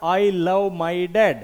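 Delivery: very slow, spelling out the scratched words; slow read-out. Retold or imitated. Teacher reading the child's scrawl on the car, very slow. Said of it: "इसने इतनी महंगी car पर लिख दिया".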